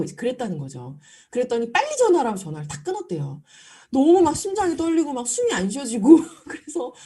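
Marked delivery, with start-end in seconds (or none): 1.7-2.4 mock angry demand. Angry customer on the phone.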